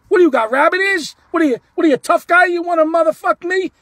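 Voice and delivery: loud, high-pitched taunting challenge; razzing. Retold or imitated. Fan razzing a ballplayer who cursed him, loud taunt. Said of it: "Now I start razzing".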